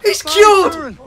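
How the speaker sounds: very high, loud cheers; jubilant shouting. Real, not acted. Viewer celebrating Bomba being cured, jubilant. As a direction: scream it out in delight.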